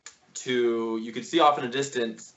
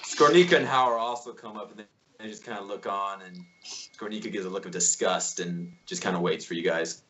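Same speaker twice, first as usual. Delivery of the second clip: usual speaking voice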